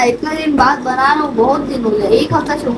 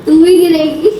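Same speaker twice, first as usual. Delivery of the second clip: laughing; 4 dB louder than usual. Real, not acted. Teasing sister after prank.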